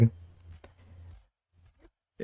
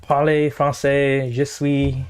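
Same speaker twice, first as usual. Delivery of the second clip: mock French accent; 3 semitones higher, much slower than usual. Mock French phrases at anthem.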